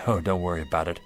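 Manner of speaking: low, soft, defeated voice; gentle, downcast surrender; resigned acceptance. Acted. Gives up and forgives the official.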